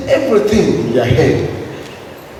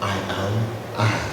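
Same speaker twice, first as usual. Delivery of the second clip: soft, much lower and quieter, smiling; said with a smile; 9 semitones lower, 9 dB quieter than usual. Retold or imitated. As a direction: let the words settle gently with a smile. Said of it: "said with a smile".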